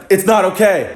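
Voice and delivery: high, indignant objection; imitated scolding girl.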